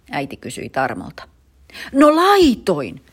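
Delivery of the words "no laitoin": high, slow, loud screech; boy screeching a defensive answer. Acted. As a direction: screech it back at mum. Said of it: "Tarmo rääkyi".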